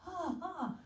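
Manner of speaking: high startled ah, ah; mock alarmed gasp; shocked gasp. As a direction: recoil in alarm. Shocked reaction.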